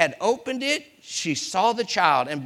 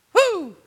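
high, loud whooping exhale; loud whew; 13 semitones higher, 6 dB louder than usual. Real, not acted. Preacher exclaiming to congregation over the river, loud whew. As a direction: let out a high-pitched, forceful whew.

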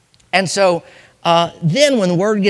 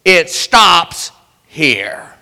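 slow drawn-out preacher cadence; preaching refrain; 2 semitones higher, 5 dB louder, much slower than usual. Acted. Preacher to congregation, slow emphatic refrain. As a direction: hammer the refrain home slowly.

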